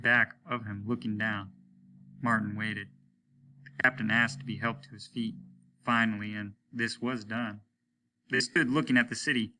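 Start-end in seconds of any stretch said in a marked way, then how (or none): none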